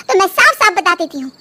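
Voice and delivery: ordinary talk, nothing marked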